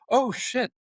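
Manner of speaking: sudden jump up high in alarm; a startled curse as the penny drops; shocked realization. Retold or imitated. Realizing villagers get shot.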